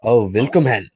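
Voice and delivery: put-on playful German-accented welcome; German greeting. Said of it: "do the German greeting".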